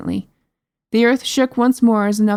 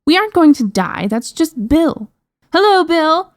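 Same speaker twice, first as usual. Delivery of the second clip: high-pitched, louder princess; exasperated reassurance into a high call; breezy dismissal; 9 semitones higher, 4 dB louder than usual. A narrator's reading. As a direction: brush off the danger, then call out. Princess dismisses danger, calls giant.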